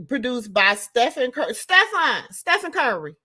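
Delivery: ordinary talk, nothing marked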